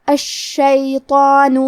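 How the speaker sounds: الشیطان very slow, doubled شین, heavy ط with long alif; heavy doubled shin. Shown as a demonstration. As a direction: recite الشیطان slowly and heavily. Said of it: "بھاری آواز میں پڑھنا ہے".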